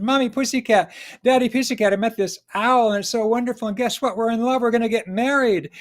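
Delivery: excited kitten. Acted. Kitten tells parents about love.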